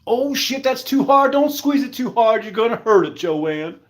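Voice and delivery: high-pitched playful panic; pretend-panicked warning; mock alarm. Acted alarm over squeezed lettuce.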